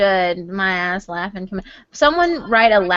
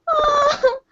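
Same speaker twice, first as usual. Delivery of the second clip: ordinary talk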